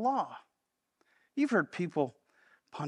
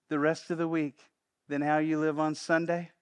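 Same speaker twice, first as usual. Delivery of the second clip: usual speaking voice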